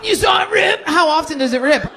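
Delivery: loud, high, laughing shout; shocked shout. Real, not acted. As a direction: blurt it out in shock. Shocked at rip statistic.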